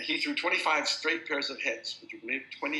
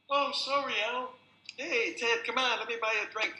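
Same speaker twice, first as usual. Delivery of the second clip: much higher, meek apologetic bloke; sheepish backdown; 10 semitones higher than usual. Retold or imitated. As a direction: back off meekly, suddenly friendly. Brawler backs down sheepishly.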